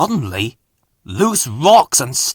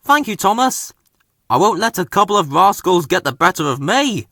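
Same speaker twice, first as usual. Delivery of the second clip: lightly voiced wheezy old engine, quick; brisk, a hint of wheeze; wheezing Fergus; 6 semitones higher, faster than usual. A narrator's reading. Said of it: "wheezed Fergus".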